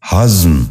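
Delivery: ہضم said as one syllable, "hazm"; correct pronunciation. Shown as a demonstration. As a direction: run z straight into m in ہضم. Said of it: "درست تلفظ ہے".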